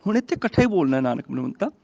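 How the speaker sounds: ordinary delivery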